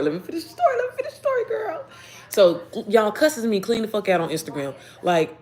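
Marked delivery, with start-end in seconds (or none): 0.0-0.8 pleading through a laugh, pitched up; laughing plea. Real, not acted. 0.8-1.8 very high-pitched playful squeal; squealing plea. Real, not acted.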